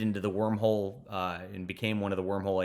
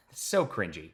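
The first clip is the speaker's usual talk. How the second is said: usual speaking voice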